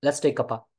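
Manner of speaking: "कपा" run together as KUP-uh; short colloquial form. Shown as a demonstration. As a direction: blend cup of into "कपा". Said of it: "आजकल लोग short में बोलते हैं". ("कपा" को जोड़कर छोटा बोलें)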